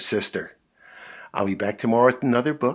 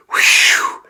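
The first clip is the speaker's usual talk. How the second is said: sharp unvoiced relieved blow; loud whew; 12 dB louder than usual. Dad blowing out relief after a close call.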